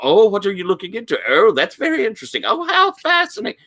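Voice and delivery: high, quick fussy quizzing; Doctor Pritchard. Acted. Occult librarian quizzing a visitor, high and fascinated. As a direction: gush with nosy interest, pitch climbing high. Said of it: "he sort of quizzes".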